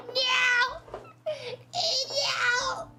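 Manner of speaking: high, wailing "no"; dismayed cry. Real, not acted. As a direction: cry out over a small mishap. Planchette mishap caused by the cat.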